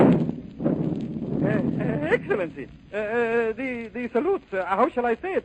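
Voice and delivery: halting, stammered approach to a superior; hesitant stammer. Acted. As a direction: stumble over fillers, searching for words. Aide nervously broaches bad news to governor.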